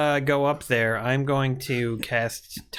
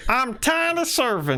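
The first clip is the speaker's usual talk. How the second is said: tiny servant voice; 10 semitones higher than usual. Pretend. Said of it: "Do you wanna dance with us?".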